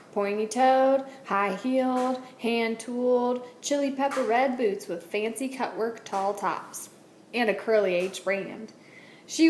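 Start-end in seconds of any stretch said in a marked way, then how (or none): none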